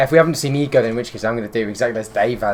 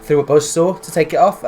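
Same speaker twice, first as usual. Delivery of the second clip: ordinary talk, nothing marked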